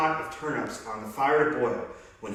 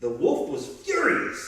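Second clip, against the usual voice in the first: ordinary delivery, nothing marked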